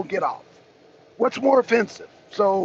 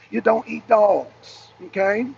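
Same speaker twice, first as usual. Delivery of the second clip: ordinary talk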